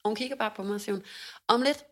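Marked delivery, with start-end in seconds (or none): 1.5-1.8 brisk, higher and louder, a doctor rattling off the plan; breezy no-nonsense doctor; quick doctor voice. Retold or imitated. Doctor briskly tells the scared patient the plan, brisk and upbeat. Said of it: "på sådan en hurtig, meget lægeagtig måde".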